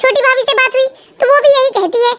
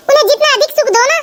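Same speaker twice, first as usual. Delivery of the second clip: ordinary talk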